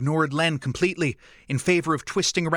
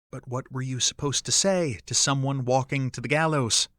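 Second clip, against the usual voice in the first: ordinary delivery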